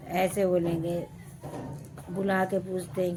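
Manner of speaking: normal talking voice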